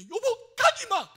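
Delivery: very high, very loud begging cry; desperate shouted plea. Retold or imitated. Husband begging his dying wife to stay, desperate shout. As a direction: cry out to hold someone back.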